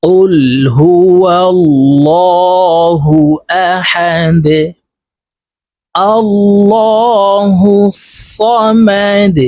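slow, drawn-out chanted recitation; Quran recitation. Shown as a demonstration. Teacher recites slowly for students. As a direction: recite it slowly in melodic tajweed.

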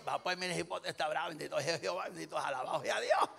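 imitating a rapid Salvadoran patter; Salvadoran accent. Preacher mimicking a Salvadoran believer, Salvadoran accent. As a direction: put on a Salvadoran accent, words run together. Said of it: "Si usted es salvadoreño".

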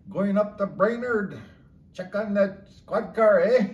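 put-on slow, loud, high-pitched Fargo accent; exaggerated Minnesota drawl from Fargo; overdone Fargo accent. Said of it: "they really overdid their accent there".

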